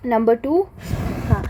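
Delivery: ordinary talk, nothing marked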